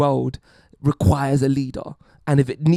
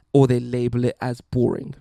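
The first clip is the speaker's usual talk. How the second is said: ordinary talk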